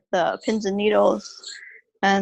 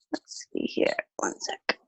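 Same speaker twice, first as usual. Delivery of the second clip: whispered aside; 11 semitones higher, 9 dB quieter than usual. Real, not acted. Fiddling with holder, muttering aside.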